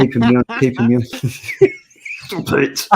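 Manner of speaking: fast, laughing through the words. Real, not acted. Teasing the co-host while laughing hard. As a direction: giggle it out quickly.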